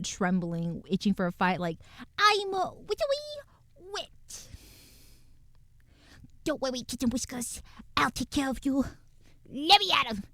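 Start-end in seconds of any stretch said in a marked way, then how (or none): 2.2-10.2 squeaky tiny creature voice. Acted. Voicing a tiny fierce pet.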